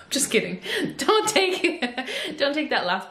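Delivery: laughing through the words after a joke; real laughter breaking into the line. Real, not acted. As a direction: take it back while giggling. Jokes about nodding, then laughs.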